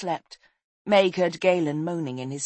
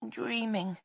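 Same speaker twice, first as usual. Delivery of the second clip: high, soft, drowsy murmur; sleepy whisper; 6 semitones higher, 7 dB quieter than usual. A narrator's reading. Boy half wakes from nightmare, whispering. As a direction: murmur it half asleep, softly. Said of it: "he whispered".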